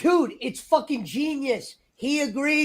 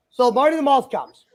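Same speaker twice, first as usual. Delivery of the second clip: ordinary talk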